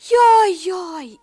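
high, loud alarmed cries; frightened. A narrator's reading. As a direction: yelp in fright. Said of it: "ijedezett a kakas".

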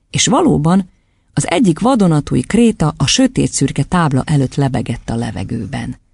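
plain reading voice

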